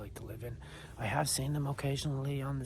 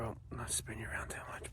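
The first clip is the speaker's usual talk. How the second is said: whispering; 9 dB quieter, faster than usual. Real, not acted. Whispering on the trail.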